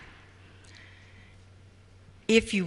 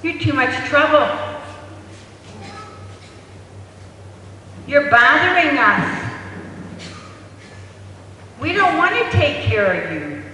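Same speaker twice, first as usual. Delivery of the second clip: higher, louder, cold dismissive parent; rejecting parent; 5 semitones higher, 3 dB louder than usual. Retold or imitated. Parents to an unwanted child, higher, louder and dismissive. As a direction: push the child away with every word.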